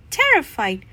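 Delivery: plain reading voice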